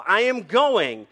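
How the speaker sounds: loud, drawn-out urgent declaration. Acted. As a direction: stretch it out, pitched high and pressing. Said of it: "Do you catch the sense of urgency".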